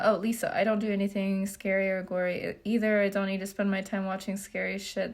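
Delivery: normal talking voice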